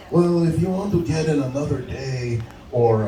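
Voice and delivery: woozy pizza manager on the phone; drunk or accented. Retold or imitated. Manager stalls the angry customer, drunk-sounding. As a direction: offer it like someone half-drunk. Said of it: "This is what I heard over the phone".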